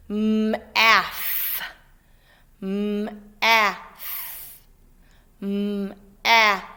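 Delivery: M-af split into sounds; sounded out. Shown as a demonstration. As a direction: stretch M-af sound by sound. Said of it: "we're gonna do it for our sounds".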